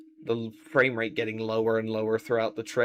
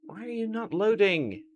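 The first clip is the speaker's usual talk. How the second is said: higher, exasperated plea to computer; 8 semitones higher than usual. Real, not acted. Second ask, pitched up.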